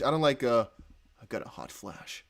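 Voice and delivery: ordinary talk, nothing marked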